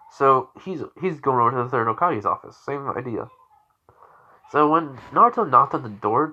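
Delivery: usual speaking voice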